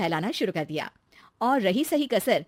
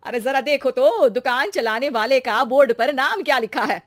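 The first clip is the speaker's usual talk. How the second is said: loud, higher call to look; emphatic mother; 4 semitones higher, 8 dB louder than usual. Acted. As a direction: call them over to see the sign. Narrator voices mother calling out. (ज़ोर से बुलाती माँ)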